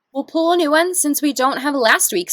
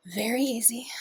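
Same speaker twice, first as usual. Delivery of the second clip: whisper; 3 semitones lower, 8 dB quieter than usual. Real, not acted. Whispered before the meditation.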